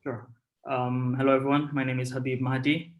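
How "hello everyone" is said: ordinary talk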